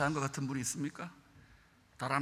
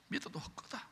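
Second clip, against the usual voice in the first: whispered; 7 semitones higher, 11 dB quieter than usual. Retold or imitated. Voices an old believer.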